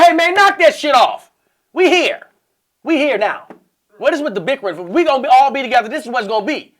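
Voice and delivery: loud, high, commanding retelling; firm scolding. Retold or imitated. Man stopping two women's bickering, loud and firm. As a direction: put your foot down loudly.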